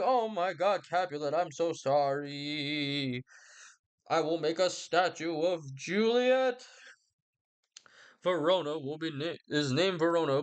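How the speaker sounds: high, very slow, syrupy reconciliation; a father melting into apology, all sweetness; high, dragged-out mock tenderness; gushing Capulet. Montague answers Capulet.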